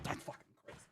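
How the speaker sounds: breathy whisper under his breath; whispered aside. Retold or imitated. Storyteller whispering to himself in the car about the translator. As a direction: whisper it so the translator can't hear.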